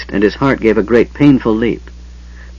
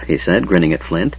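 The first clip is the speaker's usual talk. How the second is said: usual speaking voice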